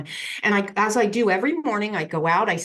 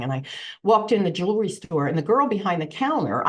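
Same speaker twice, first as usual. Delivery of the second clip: ordinary talk, nothing marked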